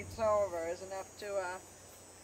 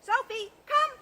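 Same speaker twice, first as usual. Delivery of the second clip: calling the dog; 9 dB louder than usual. Real, not acted. Dog training recall call.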